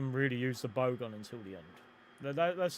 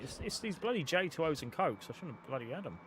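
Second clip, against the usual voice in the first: usual speaking voice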